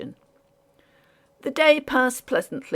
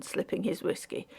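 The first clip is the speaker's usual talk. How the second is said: ordinary delivery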